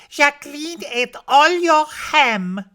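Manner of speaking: put-on high, loud little-girl voice; Josette. A narrator's reading. Small girl telling on the maid to Papa, high and loud. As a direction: pipe up high like a tattling child. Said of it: "says Josette".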